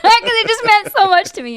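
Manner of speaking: high voice breaking into laughter; laughing, emotional. Real, not acted. Veteran moved by her sergeant remembering her, laughing.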